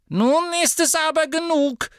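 very high, sharp shopkeeper voice; cutting, high-pitched witch. A narrator's reading. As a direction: cut them off sharply. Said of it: "sagte Madame Malkin schneidend".

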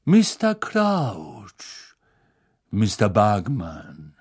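slow oily unctuous voice. Acted. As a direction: drawl it in an oily tone. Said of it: "mit salbungsvoller Stimme".

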